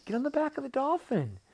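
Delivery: high, loud, excited creature voice; eager cartoon octopus; urging octopus. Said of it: "the octopus says".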